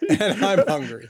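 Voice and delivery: slow, chuckling admission; laughing at himself after the tears. Real, not acted. Husband admits to the counsellor he is hungry, laughing. Joking after long crying.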